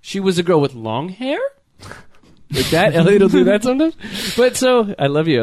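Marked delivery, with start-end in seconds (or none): 0.0-1.4 statement turned into a question, rising steeply on "long hair"; imitated upspeak, lifting "long hair?" into a question; Elliot. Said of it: "but ask them, like, a question". 2.5-4.0 rising steeply to the end on "sometimes"; demonstrated upspeak, climbing through "sometimes"; statement as question. Shown as a demonstration. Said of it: "ask them, like, a question".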